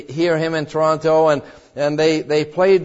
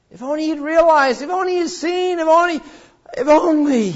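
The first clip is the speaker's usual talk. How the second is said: longing lament; 9 semitones higher than usual. Acted. Lament over missed chance.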